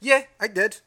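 high, loud, brazen admission; unapologetic dog. Retold or imitated. Dog answering accusing owner, unapologetic. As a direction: own up loudly with no remorse. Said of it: "she did this thing".

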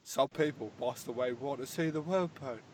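solemn London-accent impression; Michael Caine. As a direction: quote it like an old Cockney butler. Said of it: "Michael Caine".

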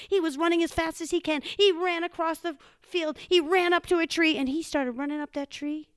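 high, loud, urgent telling; breakneck chase narration. A narrator's reading. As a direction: race through it as the chase unfolds.

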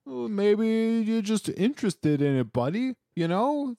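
high sing-song teasing buddy voice; mock condescension. Cohost teases the weather question in a high mock-patronizing voice. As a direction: talk down to him in a high mock-friendly voice.

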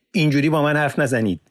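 high, loud angry cutoff; angry interruption. Acted. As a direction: snap back and cut him off. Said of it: "با خشم سخن او را برید".

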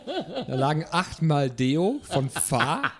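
slow words breaking into laughter; laughing. Real, not acted. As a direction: read it while cracking up. Laughing while reading a list.

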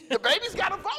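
laughing, incredulous question; a real laugh at the absurd idea; amused disbelief. Real, not acted. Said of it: "The baby's on the board?".

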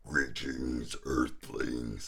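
low, stiff sci-fi alien greeting. Retold or imitated. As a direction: drop the voice like a B-movie alien. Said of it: "Nobody talks like that".

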